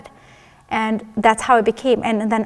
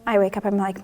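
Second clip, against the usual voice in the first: normal talking voice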